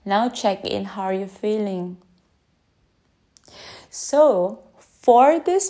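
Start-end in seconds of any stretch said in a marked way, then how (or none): 0.1-2.0 guided check-in. Acted.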